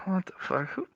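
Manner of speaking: exasperated mutter. Real, not acted. Sighing, giving up.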